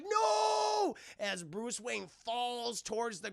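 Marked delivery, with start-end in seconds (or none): 0.0-1.0 very high loud cry of no; horrified shout. Retold or imitated. Mad Hatter watching Bruce fall, shouting. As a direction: scream it in panic.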